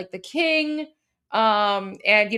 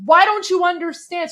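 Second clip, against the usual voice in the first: higher, louder mock shout; imitated frustrated yell; 7 semitones higher, 6 dB louder than usual. Translating his shout at the woman, mock yell. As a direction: fling it out in exasperation. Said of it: "he's so frustrated".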